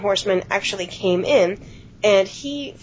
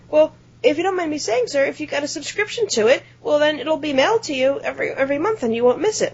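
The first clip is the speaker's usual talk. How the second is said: higher-pitched, eager-to-please suggestion; polite customer service voice, pitched up; helpful clerk; 6 semitones higher than usual. Retold or imitated. Clerk suggests a subscription to caller, higher, trying to help. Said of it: "I was trying to be helpful".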